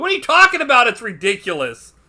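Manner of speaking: loud, high-pitched scoffing; mock outrage. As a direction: scoff in exaggerated disbelief. Continues the adults' mocking quote.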